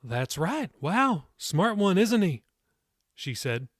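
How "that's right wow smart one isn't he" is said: impressed. A narrator's reading. Old lady impressed by boy's answer.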